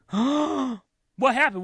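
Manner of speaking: hearing shocking celebrity news; shocked gasp question. Retold or imitated. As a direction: ask it with a startled gasp. Gasping at news on phone.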